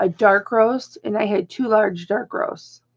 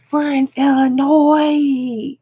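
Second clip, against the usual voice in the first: drawn-out, raised retold protest; mock dismayed wail; 4 semitones higher, 3 dB louder, slower than usual. Traveler protesting to the girl, mock wail. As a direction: wail it slowly in mock panic. Retells panicked objection to the girl.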